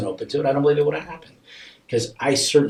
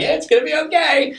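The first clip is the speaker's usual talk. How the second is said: very high, loud, overdone cheer; forced smiling reassurance; 7 dB louder than usual. Retold or imitated. Parent hiding panic from the child, forced smiling reassurance. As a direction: reassure through a pasted-on smile. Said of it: "you're just smiling like".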